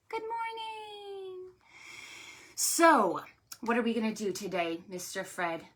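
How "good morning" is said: sing-song hello. Real, not acted. High soft drawn-out greeting.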